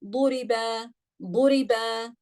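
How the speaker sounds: Duriba with every vowel kept short. Shown as a demonstration. Teacher shows class short vowels in duriba. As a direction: say duriba quick and even. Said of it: "It's a short sound".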